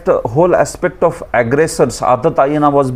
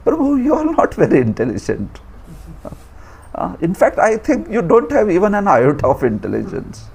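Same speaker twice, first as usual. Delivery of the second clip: high-pitched, mock-polite put-down; roundabout put-down; 5 semitones higher than usual. Shown as a demonstration. Teacher showing another way to call Prabhu foolish, high mock-polite put-down. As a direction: deliver an insult as if being tactful.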